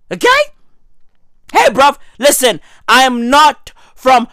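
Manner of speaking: ordinary delivery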